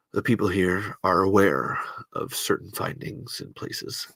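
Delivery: low, soft voice ushering listeners into another zone; Rod Serling. Shown as a demonstration. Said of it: "Rod Serling is the answer".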